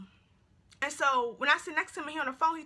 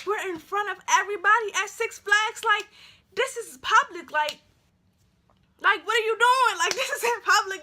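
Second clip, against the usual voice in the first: higher, loud, scolding appeal; acted embarrassed protest; 6 semitones higher, 6 dB louder than usual. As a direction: protest loudly, mortified at the public scene. Retold embarrassment at public quarrel.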